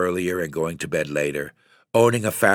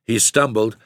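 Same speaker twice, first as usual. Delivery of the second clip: ordinary delivery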